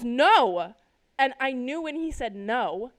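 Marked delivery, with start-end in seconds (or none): 0.0-0.7 loud imitated yell of refusal; alarmed refusal. Dad refusing to run over the squirrel, alarmed yell. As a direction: belt out one drawn-out word.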